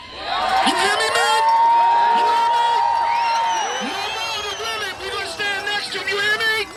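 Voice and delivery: calling out to the men in the crowd, shouting high; fired-up shouting. Real, not acted. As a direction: rouse the men at full force. Speaker rallying the men.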